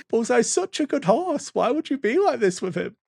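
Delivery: put-on a child pleading on her toy horse's behalf; imitating an upset child. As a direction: protest like a tearful kid defending her horse. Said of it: "and it's like".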